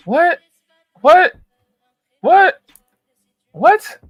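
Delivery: high, loud stunned repeats; real shock, yelled what; incredulous what. Real, not acted. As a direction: fire each what in disbelief. Repeated disbelief at the clip.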